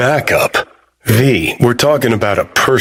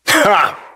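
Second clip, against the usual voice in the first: one high "ha"; short burst of amused laughter; delighted laugh; 10 semitones higher than usual. Acted. Laughing at the prophet's sayings, delighted.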